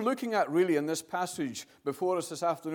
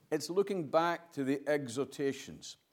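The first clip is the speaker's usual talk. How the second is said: normal talking voice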